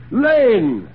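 loud, high summons to the butler; sharp call. A narrator's reading. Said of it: "said Sir William sharply".

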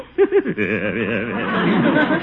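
long rippling chuckle; helpless chuckle. Acted. Uncle amused by the young man's houseboat deal, helpless chuckle. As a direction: chuckle away, unable to hold it in. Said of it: "Excuse me for laughing".

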